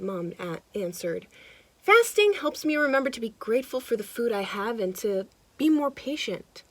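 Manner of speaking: lively storybook narration; animated read-aloud for kids; reading to children. A narrator's reading. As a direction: read it like a picture book to a child. Reading picture book aloud.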